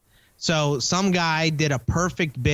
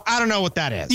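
quick imitation of a huffy dismissal; mock indignant; 3 semitones higher, faster than usual. Host mimicking co-host who never heard of it, mock indignant. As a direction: mimic indignant ignorance, lightly. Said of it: "like such an indignant a-hole".

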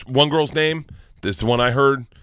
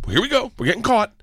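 higher, gleeful "here we go"; gleeful inner voice; 11 semitones higher than usual. Retold or imitated. Dad catching his daughter's lie, gleefully higher. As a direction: savor the catch.

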